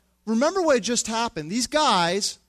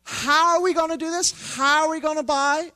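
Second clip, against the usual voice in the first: much higher, fretful disciple; hammed-up worried disciple, much higher and louder; mock panic; 10 semitones higher, 4 dB louder than usual. Disciples panicking, voiced by preacher.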